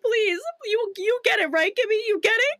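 high, whiny, desperate begging; pleading Carly voice. Retold or imitated. Carly begging Gibby to understand, high and pleading. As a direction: beg your best friend in a high plea. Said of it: "asking if he understands why".

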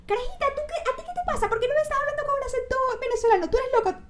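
very high-pitched mock scolding mother; hammed-up high falsetto telling-off; mom scolding. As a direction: imitate an outraged mom telling off her kid in a squeaky voice. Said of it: "me ha llamado mi mamá y me ha dicho".

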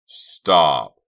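loud, anguished shout; frantic yell; desperate cry. Acted. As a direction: cry out to stop him. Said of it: "Chuck's desperate cry".

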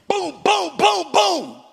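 high, punchy mock thuds; spoken booms, one per blow; imitated baton blows. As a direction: act out a beating in sound.

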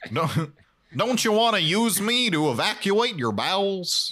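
high, cheery appliance voice; sing-song eager toilet voice; talking toilet voice. Pretend.

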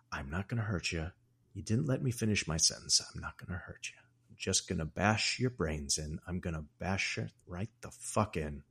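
imitating Jack quietly threatening Wendy; hushed menace. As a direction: murmur the violence like a secret. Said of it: "He's doing different voices".

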